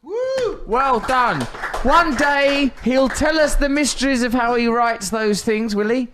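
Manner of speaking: high, loud cheering host; excited. Real, not acted. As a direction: burst out with delight. Host cheering after poem.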